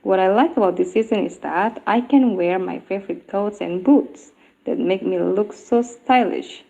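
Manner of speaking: ordinary delivery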